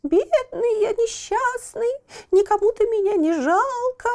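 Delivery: high thin whimper for pity; thin plaintive whine. A narrator's reading. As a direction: whine pitifully like a little wooden boy. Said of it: "подвывать жалобным тоненьким голоском".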